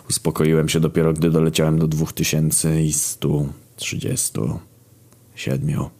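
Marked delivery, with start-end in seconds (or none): none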